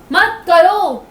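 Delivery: angry dismissal. Real, not acted. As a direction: fling it at him, stretching the end. Wife snaps dismissal at husband.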